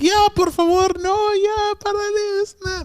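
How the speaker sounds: very high imitation of a pleading girl in Spanish. Storyteller mimics the girl begging the guy to stop, high pleading voice. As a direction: put on a high female voice begging him to stop.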